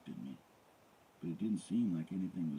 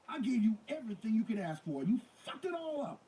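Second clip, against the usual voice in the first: raging; 5 dB louder than usual. A narrator's reading. Nicole raging at O J.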